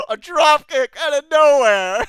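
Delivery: loud, high, drawn-out exclamation; astonished, slow and loud; amused disbelief. Real, not acted. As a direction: shout it out, about to burst out laughing. Cracking up at sudden kick.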